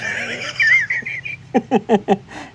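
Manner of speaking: a long, very high burst of laughter; high cackling laugh. Real, not acted. Host cracking up at a viewer's comment. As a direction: crack up completely.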